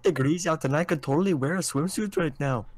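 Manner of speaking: high, singsong exaggerated Minnesotan; Minnesotan accent. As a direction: play a chipper Minnesota local, pitch swooping. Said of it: "miss the Minnesotan stuff".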